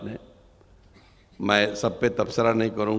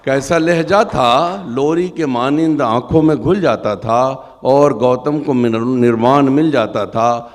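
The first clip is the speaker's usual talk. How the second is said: higher, louder impassioned repeat; impassioned recitation; 7 semitones higher, 15 dB louder than usual. Acted. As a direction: lift the repeated line high.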